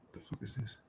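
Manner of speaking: hushed, breathy aside; whispered aside. Real, not acted. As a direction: drop to a whisper for the curse.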